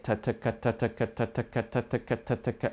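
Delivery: quiet even ta-ta-ka; quiet triple tonguing chant; triple tonguing drill. Shown as a demonstration. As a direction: chant ta-ta-ka softly. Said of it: "you're just gonna say it".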